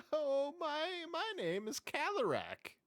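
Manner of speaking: high, nervous little demon voice; whiny imp voice. Acted.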